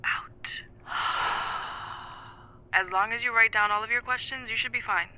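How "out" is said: breathing coach. Shown as a demonstration. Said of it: "breathe with me".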